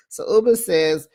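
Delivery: usual speaking voice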